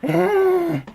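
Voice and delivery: high, strained "ah" of exhaustion; tired groan. Shown as a demonstration. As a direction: groan high to show how worn out you are. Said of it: "hear the sound of my voice, I'm going".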